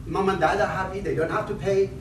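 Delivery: normal talking voice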